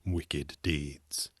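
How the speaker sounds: ordinary delivery, nothing marked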